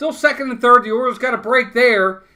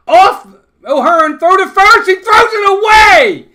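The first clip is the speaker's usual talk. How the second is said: much higher, louder disbelieving yell; stunned broadcaster screaming the error; shocked shout; 10 semitones higher, 9 dB louder than usual. Real, not acted. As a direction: shout as the throw sails into the dugout. Shocked call of throwing error.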